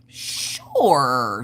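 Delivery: noncommittal, stretched-out yes; drawn-out agreement. Retold or imitated. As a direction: stretch it out doubtfully, playing along. Hesitant, placating answer to optician.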